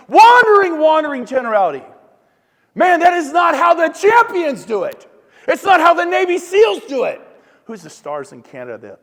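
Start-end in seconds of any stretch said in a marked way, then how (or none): 0.1-0.8 much louder and higher, one word; scornful loud shout at the audience. Real, not acted. Scorning the aimless attitude. 0.8-1.8 louder but calmer follow-up; a firmer, calmer repeat of the label. Real, not acted. 2.7-7.2 laughing shout at the agents. Real, not acted. Scorning the aimless agents.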